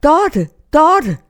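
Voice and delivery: loud, high search call; retold calling out. Searcher calls for the lost girl in the forest, high retold shout. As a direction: call out for a missing child. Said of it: "Og han råbte".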